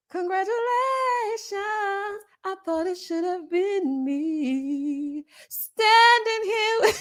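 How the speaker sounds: a slow, high, hammed-up mock-jealous guest; lightly voiced bitter guest, slow and high. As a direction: drag it out like the jilted one. Mocking a rival at the party.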